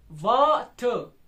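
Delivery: Water slowly, loud long वा then weak टर; water split in two, falling on टर; strong-weak stress. Shown as a demonstration. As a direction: punch and stretch वा in water. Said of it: "पहला strong हो रहा है".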